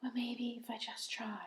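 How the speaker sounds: whispered, hesitant high puppet. Pretend. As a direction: whisper the brave try. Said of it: "the puppet goes".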